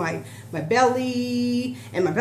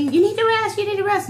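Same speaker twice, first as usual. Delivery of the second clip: mock husband's voice, much higher and louder; husband fussing; 13 semitones higher, 6 dB louder than usual. She imitates her husband fussing at her to rest, high mock nag. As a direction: playfully imitate a nagging husband. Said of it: "he'll probably fuss at me".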